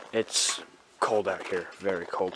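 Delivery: slow, quiet shivering voice. Real, not acted. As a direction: talk through the shivers in the cold. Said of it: "like, shivering".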